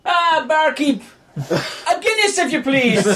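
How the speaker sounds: high, slow mock Irish request; old Irish man. Old man ordering a Guinness, high slow mock Irish. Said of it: "And Da's like".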